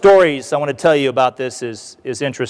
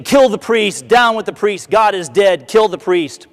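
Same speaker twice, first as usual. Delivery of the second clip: raised, higher chant of an angry mob; crowd shouting; 5 semitones higher than usual. Retold or imitated. Speaker voicing the Paris mob calling for the priest's death, raised chant. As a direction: yell it like the whole crowd wants blood. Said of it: "they were shouting and screaming at him".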